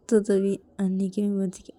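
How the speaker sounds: ordinary delivery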